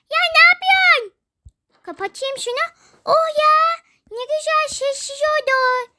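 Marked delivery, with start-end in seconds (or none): 0.1-1.1 high, fast, loud protest; indignant shout. Acted. Child yells at friend who closed the door. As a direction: yell at the friend.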